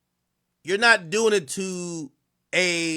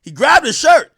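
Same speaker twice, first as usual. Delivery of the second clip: loud, high-pitched burst of alarm; excited reaction; 7 semitones higher, 11 dB louder, slower than usual. Real, not acted. Commentator spots the security guard grabbing a shirt, excited outburst. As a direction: exclaim it, much louder and higher.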